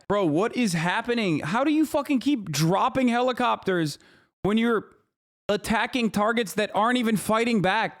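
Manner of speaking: incredulous rising rant; exasperated rant. Real, not acted. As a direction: rant at how absurd it is. Ranting at military failure.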